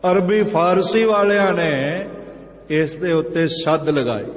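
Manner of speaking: ordinary delivery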